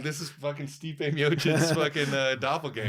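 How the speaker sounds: loud, high amazed exclamation; excited. Retold or imitated. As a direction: blurt it out like you can't believe it. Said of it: "bro, I'm like".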